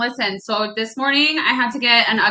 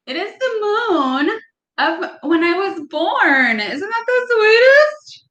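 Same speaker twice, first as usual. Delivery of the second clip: teary; 9 semitones higher than usual. Real, not acted. Showing a gift that made her cry.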